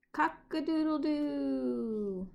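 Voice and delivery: high, slow, loud cock-a-doodle-doo; rooster crow. A narrator's reading. Reading a picture book to kids, crowing like the rooster, high and drawn out. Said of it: "and the rooster says".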